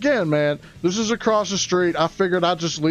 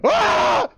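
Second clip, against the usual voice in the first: mock horrified yelp; 3 dB louder than usual. Reliving the horror.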